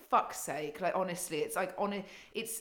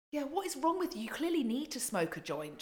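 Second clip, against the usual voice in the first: exasperated; 7 semitones higher than usual. Real, not acted. Exasperated rant at a politician.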